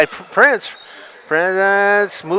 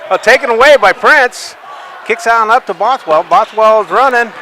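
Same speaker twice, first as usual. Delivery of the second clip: louder, higher, rising call; excited play-by-play; 5 semitones higher, 3 dB louder than usual. Real, not acted. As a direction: call the fast break with rising energy. Steal and fast break.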